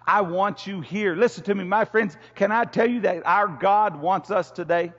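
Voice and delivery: loud, high, impassioned preaching. Acted. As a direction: preach it out full force to the church. Preaching at full force.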